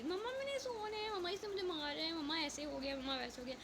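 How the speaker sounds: imitating a whining child, starting high and settling down; high-pitched mock kid nagging that fades; pestering child. Voices children interrupting her prayer.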